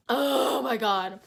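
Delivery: slow groan around a sneeze; sneezing. Real, not acted. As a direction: caught mid-sneeze, drawn out. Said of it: "Hold on, I'm about to sneeze again".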